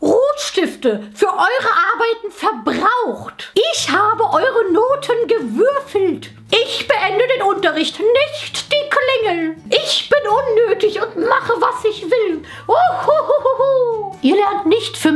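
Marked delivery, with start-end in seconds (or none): 0.0-12.4 high, slow, smug teacher voice. Acted. Teacher voice in sketch. 12.7-14.2 high, slow gloating laugh. Acted.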